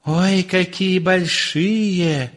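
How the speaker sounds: high, slow marvelling; wondering wife. A narrator's reading. Wife sees the huge eggs, slow high amazement. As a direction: draw it out in high-voiced wonder.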